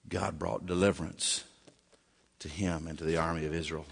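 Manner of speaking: ordinary talk, nothing marked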